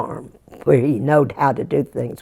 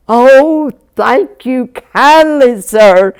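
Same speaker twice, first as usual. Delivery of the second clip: delighted thanks; 7 semitones higher, 6 dB louder, much slower than usual. Acted. Jack thrilled by puppy gift.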